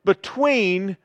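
ordinary delivery

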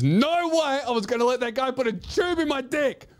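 high-pitched, indignant comic outburst; emphatic defiance. Real, not acted. Comic defending his refusal to the audience, indignant high outburst. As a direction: protest it at the top of your range.

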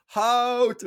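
mock holler, pitched right up; imitated shout. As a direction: mimic calling after someone down the street. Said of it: "hab ich einfach geschrien".

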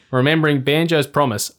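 ordinary delivery

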